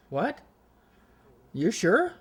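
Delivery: high-pitched, questioning double-take; retold surprise, sharply rising pitch; astonished disbelief. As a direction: imitate someone startled and doubtful. Acts out sceptic's startled reply.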